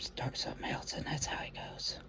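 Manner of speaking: soft whispered aside; whispering. Real, not acted. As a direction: drop to a whisper. Aside while joking about unfinished projects.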